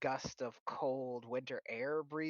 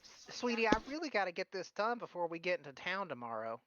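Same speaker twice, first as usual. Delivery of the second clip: put-on high, busy old granny; Meemaw voice; 5 semitones higher than usual. Philia hurrying her work before town, high granny voice. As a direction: fuss like a busy grandma.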